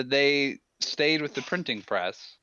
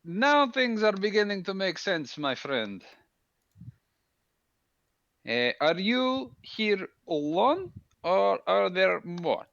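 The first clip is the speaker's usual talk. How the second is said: a lightly voiced soldier, formal and friendly; Minx in character; 5 semitones higher, slower than usual. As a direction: speak as Minx, stiff and formal.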